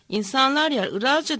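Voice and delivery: ordinary delivery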